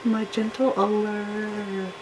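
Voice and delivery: high, breathy-sweet mock falsetto of a fake innocent woman; mocking the wife's fake innocent sweetness in a high voice. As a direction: sneer as you imitate her sugary gentle voice. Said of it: "she don't fall for her".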